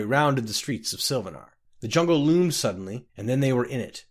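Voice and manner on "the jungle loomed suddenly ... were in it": usual speaking voice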